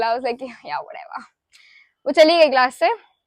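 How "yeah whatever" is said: much softer, offhand shrug; playing it cool. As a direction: toss it off like you don't care. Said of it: "played it cool". (बहुत धीमी, बेपरवाह "yeah, whatever")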